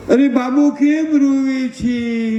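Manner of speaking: high, slow doting coo; cooing fuss over baby. Retold or imitated. Aunt fussing over the crying baby, high slow coo.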